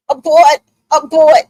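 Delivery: loud panicked call to abort; yelling abort. Retold or imitated. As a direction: shout it in alarm, high and loud. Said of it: "I was like".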